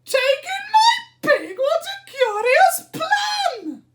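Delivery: put-on very high, loud squeaky old lady; little old lady. A narrator's reading. Old lady protests to the wise man, high and squeaky.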